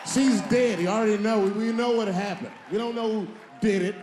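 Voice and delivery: high, loud insistent rant; ranting shout. Acted. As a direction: hammer the point home to the crowd. Comic rant, building shout.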